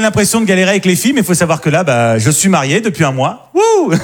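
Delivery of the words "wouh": high whoop; cheering. Real, not acted. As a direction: celebrate with a whoop. Self-cheering after marriage news.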